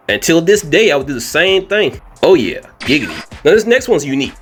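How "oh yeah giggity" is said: lightly voiced giggity in a put-on voice; giggity impression. Vlogger joking to viewers, lightly voiced giggity. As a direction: slide a cheeky giggity in a borrowed voice.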